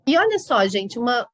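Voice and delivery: ordinary talk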